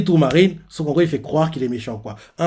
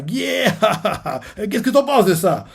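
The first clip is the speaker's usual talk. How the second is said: high laughing cheer, a bit less put-on; Blackbeard gloating; 7 semitones higher than usual. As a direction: crow with glee, laughing. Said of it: "Barbe Noire fait". (Barbe Noire jubile en riant)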